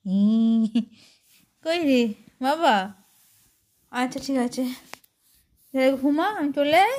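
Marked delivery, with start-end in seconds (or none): 1.7-6.9 high, loud coaxing calls; calling out. Real, not acted. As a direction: coax the pet to get up, loudly. Loudly coaxing pet to go out.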